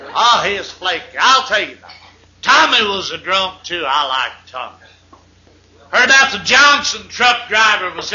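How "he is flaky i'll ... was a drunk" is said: normal talking voice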